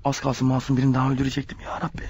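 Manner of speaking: relieved aside. Acted. Dick aside to himself after nearly shooting an innocent man, hushed relief. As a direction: say it under your breath to yourself.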